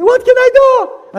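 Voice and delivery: high, loud, slow cry of despair; pleading at the top of the voice; desperate plea. Acted. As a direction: beg for help, stretching it out.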